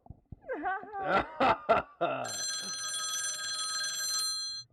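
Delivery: long, falling chuckle; shared laugh. Acted. Friends relieved the nightmare is over, shared laugh. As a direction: laugh easily after the banter.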